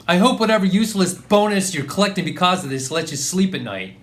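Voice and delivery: loud, high-pitched angry quitting; furious outburst. Acted. Employee quitting on the phone to the boss, furious. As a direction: explode at the boss and hurl the words.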